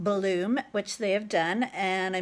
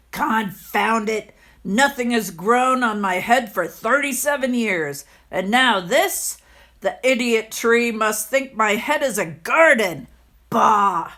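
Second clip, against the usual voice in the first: put-on cranky old man; 5 dB louder than usual. The landlord cursing the tree on his head, loud and irritated.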